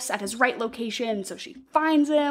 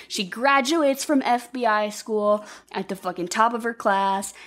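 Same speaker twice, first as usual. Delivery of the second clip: normal talking voice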